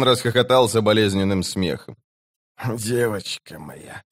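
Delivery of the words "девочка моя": slow, soft wheeze of a wounded man; wheezed, dying. A narrator's reading. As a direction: wheeze it out quietly and slowly. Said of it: "прохрипел он". (медленный тихий предсмертный хрип)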